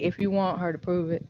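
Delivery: quiet. Retold or imitated. Quoting someone, much softer.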